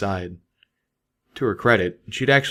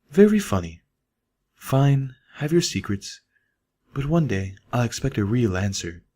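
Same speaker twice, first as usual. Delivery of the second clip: higher, wry mare voice letting it go; higher voiced Velvet chuckling off a joke; amused Velvet; 3 semitones higher than usual. A narrator's reading. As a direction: play along, but promise to come back to it. Velvet answers Calamity's teasing.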